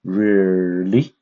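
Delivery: really in a V-shaped tune; falling and rising tone. Shown as a demonstration. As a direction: stretch really down and up. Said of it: "the stress is going down and up".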